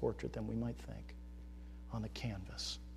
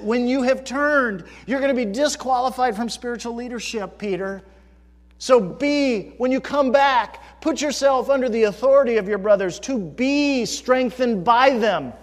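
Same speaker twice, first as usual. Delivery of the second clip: high, loud mock rewrite of Jesus' words; mock expected version; 11 semitones higher, 13 dB louder than usual. Preacher voicing the opposite of what Jesus said, loud and high. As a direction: declaim the wrong version with scorn. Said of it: "It should read exactly the opposite".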